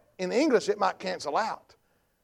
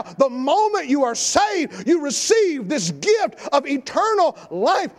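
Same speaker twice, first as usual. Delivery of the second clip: preaching at full force; 6 semitones higher, 4 dB louder than usual. Acted.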